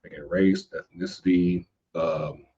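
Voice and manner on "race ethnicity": usual speaking voice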